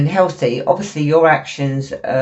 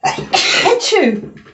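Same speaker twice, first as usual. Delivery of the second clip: loud sneeze. Real, not acted. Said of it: "it only blew my head off".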